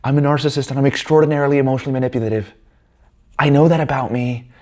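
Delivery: high-pitched, sweeping self-accusation; pitched well up, owning it with exaggeration; mock confession. Owning her accusation of being a narcissist, mock confessing.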